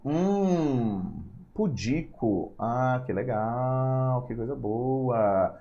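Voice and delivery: drawn-out, musing mock approval; slow, softly dragged-out pretend wonder; mock admiration. Shown as a demonstration. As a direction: stretch each word slowly as if savouring it. Teacher mock-admires a tricky word.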